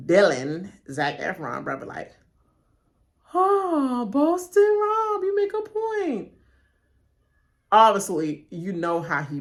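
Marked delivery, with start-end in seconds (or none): 3.3-6.3 high, slow, drawn-out gushing voice; mock fawning fan. A player fawning over Boston Rob, high mock gush. Mocking a contestant's flattery.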